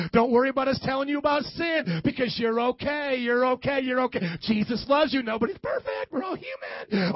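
ordinary talk

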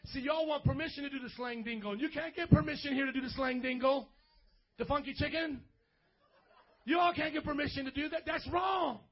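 loud, high-pitched preacher rebuke; hammed-up shouted telling-off, building to the end; mock scolding. Pastor refusing teens permission to dance, loud mock scolding.

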